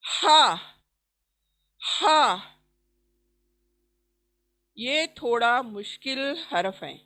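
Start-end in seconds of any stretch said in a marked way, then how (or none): 0.0-2.5 हा rasped hard from mid throat; raspy throat ha. Shown as a demonstration. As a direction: push हा out with friction. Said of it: "देखिए आवाज". (गले से खुरदुरा हा)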